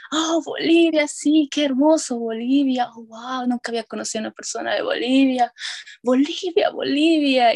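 imitating a delighted, high-pitched reaction; very excited. Bank employee thrilled to hear of Bolivia, imitated excitement. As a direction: gush in mock amazement, pitch jumping up. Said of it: "se queda tan emocionado de escuchar Bolivia".

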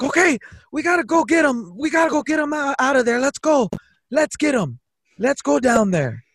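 high-pitched, fired-up rallying cry; rally your buddy for a rescue; acted hyped-up urging; urgent rallying. Rallies friend to rescue their mate.

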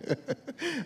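high burst of real laughter at his own joke; hearty laugh. Real, not acted. Preacher laughing with the congregation at his joke.

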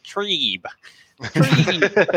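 high, strange alien voice. Retold or imitated. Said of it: "like, an alien voice".

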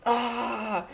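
high, soft imitated whimper of pain; mock pain groan. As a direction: imitate a fighter clutching his eye with a thin whimper. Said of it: "holding his eye going".

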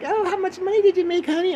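high falsetto mom impression; doting mom, hammed up; proud mom. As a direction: coo in a very high motherly voice. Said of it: "My mom was so proud".

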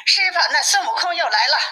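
high-pitched, alarmed announcement; voiced messenger, pitch well up; urgent report. A narrator's reading. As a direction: rush in with pressing news. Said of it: "急入里报道".

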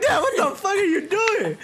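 high, laughing cry of disbelief. Retold or imitated. Son laughing at dad boxing in VR, high disbelief. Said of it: "I'm like".

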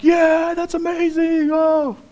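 the audience gushing over the lift; mock crowd cheer. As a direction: gush like an awed fan.

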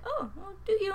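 high, surprised mock question; surprised coworker. Imitating coworker, high surprised question. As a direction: ask it taken aback. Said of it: "She's like".

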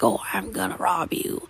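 low pretend robber voice; play bandit. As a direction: drop low like a kid playing bandit. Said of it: "you act like".

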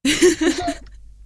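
a loud, high burst of laughter. Real, not acted. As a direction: crack up at the answer. Laughs at Misha's reply.

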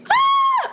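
sudden high shriek of panic; startled shriek. Real, not acted. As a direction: yelp in fright at the wasp.

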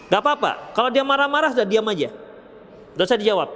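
ordinary talk

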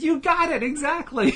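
very high, loud, laughing exclamation; gleeful retort. Retold or imitated. Joel delighted the skeptic has proved his point, gleeful.

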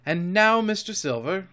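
mildly heated address to a captain; excited outburst. A narrator's reading. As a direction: break out with a touch of excitement. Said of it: "I broke out again".

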